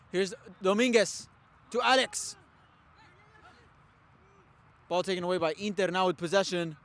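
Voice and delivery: brisk live sports commentary; play-by-play commentary. Real, not acted. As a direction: call the match as it happens.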